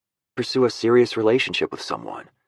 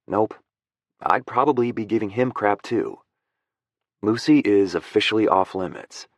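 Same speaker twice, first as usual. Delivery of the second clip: usual speaking voice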